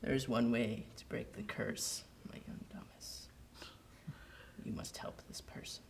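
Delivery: hushed, breathy ghost whisper; ghostly whisper. Acted. Ghost whispering to Thomas, hushed and eerie. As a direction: whisper like a spirit. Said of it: "I'd be like".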